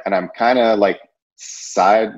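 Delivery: ordinary delivery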